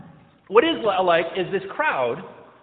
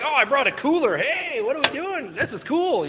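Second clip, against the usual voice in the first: higher, sing-out party-goer voice; carefree follower; 6 semitones higher than usual. Acted. Follower at the back chats on the road, carefree. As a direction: play an easygoing tagalong, pitched up, stretching the greeting.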